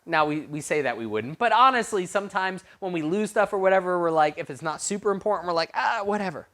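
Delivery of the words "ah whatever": high-pitched brush-off; mock dismissive shrug. People losing unimportant stuff, mock dismissive. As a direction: toss it away with a mock shrug.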